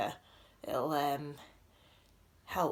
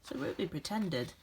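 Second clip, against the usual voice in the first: gentle, expressive storybook reading with dramatic pauses; reading to children; 3 dB louder than usual. A narrator's reading. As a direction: read it like a bedtime picture book for a class. Reads picture book aloud.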